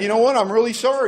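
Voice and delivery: a lightly voiced, guilty apology; apologetic brother-in-law. And he felt terrible.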